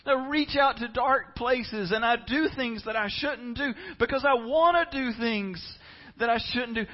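loud, high, crying plea; pleading confession. Pretend. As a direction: cry out the confession. Voicing a confessing sufferer.

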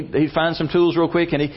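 usual speaking voice

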